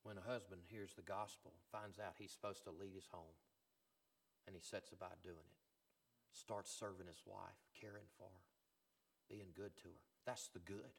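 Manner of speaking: soft, low, tender pulpit voice; hushed preaching. Real, not acted. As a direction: bring it right down, gently offering each example. Preaches softly with gentle examples.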